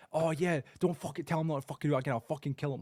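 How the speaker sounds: hammed-up rant from a raging dad; mock angry dad. Son imitating his furious dad's threat, mock angry shout.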